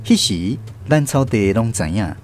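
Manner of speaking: plain reading voice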